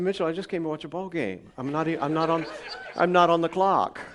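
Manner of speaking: pastor's amused protest, laughing through it; laughing reply. Retold or imitated. Pastor tells drunk man at game, laughing. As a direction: brush it off with a laugh. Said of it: "I said".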